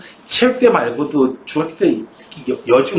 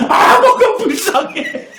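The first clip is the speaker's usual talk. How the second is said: very high, slow, laughing wail of a sobbing frog; hammed-up sobbing frog, high and drawn out; crying frog voice; 4 dB louder, slower than usual. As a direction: whimper it like a weepy little frog through laughter. Frog's third tearful reply.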